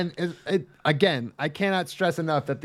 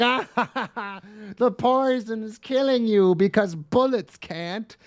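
high, laughing mock gloat; a half-laughed cartoon villain boast; 7 semitones higher, slower than usual. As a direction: ham up the villain's triumph.

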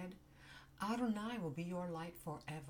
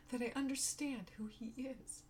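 tearful; 8 semitones higher than usual. Real, not acted. Emotion rising, voice breaking.

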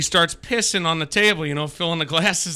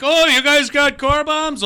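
high, slow mock Irish accent; lightly put-on Irish accent of a clueless tourist; fake Irish accent; 11 semitones higher, 4 dB louder, slower than usual. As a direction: imitate a bad Irish accent, pitched up. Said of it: "your fake accent".

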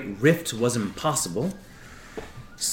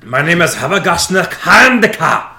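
higher, louder, rough fisherman voice; gruff fisher voice; 7 semitones higher, 10 dB louder than usual. Acted. Fisher character tells his clan's tale in a rough voice. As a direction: tell it like a salty old fisher.

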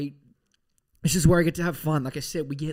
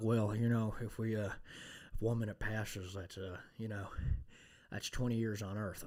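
hushed, laid-back actor imitation; Matthew McConaughey; 5 semitones lower, 11 dB quieter than usual. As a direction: murmur it low with lazy hesitations. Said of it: "Matthew McConaughey was like".

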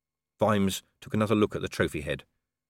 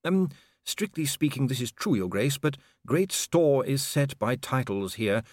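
hesitant pedant correcting a superior; official, tactfully correcting; 5 semitones higher than usual. Acted. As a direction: explain protocol with careful deference. Voices Inigo, fussy official.

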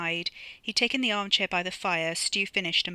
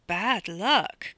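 mocking echo; 2 semitones higher, 5 dB louder than usual. Paul mocks Bunty's words.

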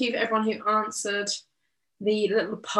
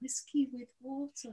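put-on Welsh accent; 3 semitones higher, 11 dB quieter than usual. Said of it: "the Welsh accent".

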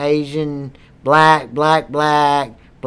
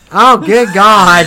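loud groan; 6 dB louder, slower than usual. Real, not acted. Loud slow groan at the smoke.

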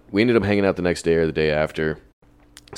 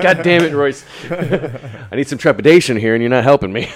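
half-laughing, louder and higher, mock outrage; laughing exasperation; 7 semitones higher, 6 dB louder than usual. Telling Royce off for cheering him on, laughing and exasperated. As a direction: laugh through a playful scolding of your friend. Venting at friend over advice.

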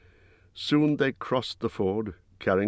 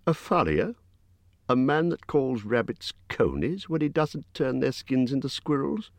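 higher, baffled hobbit; a small voice pitched up in confusion; Bilbo puzzled; 5 semitones higher than usual. A narrator's reading. Hobbit misunderstanding the word skin changer, higher and baffled. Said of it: "asked Bilbo".

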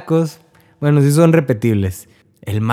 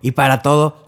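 ordinary delivery

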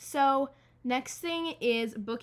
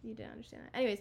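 normal talking voice

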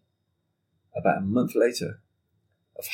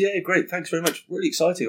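excited client; 7 semitones higher than usual. Retold or imitated. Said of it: "And he said".

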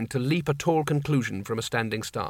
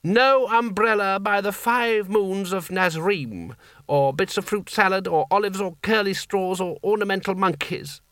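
put-on high, slow, grand old potentate; commanding sultan; 7 semitones higher than usual. Seraph commanding the genie.

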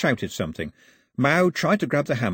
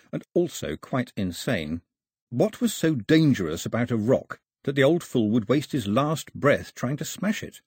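usual speaking voice

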